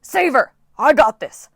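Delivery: loud, high shout from above. Acted. Coach Hedge shouting down while carried into the clouds. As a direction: shout it while rising away. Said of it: "Coach Hedge shouted down once more".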